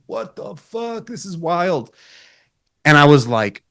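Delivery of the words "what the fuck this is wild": retold amazed disbelief. Retold amazement at the surreal ceremony.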